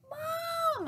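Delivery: high, drawn-out shout for Mom; excited kid's cry; yell. A narrator's reading. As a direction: holler to your mom in amazement. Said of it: "I yelled".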